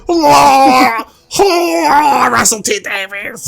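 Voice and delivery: fake-sob his own name in ecstasy; sobbing, squealing mock rapture; mock crying. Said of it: "He's like".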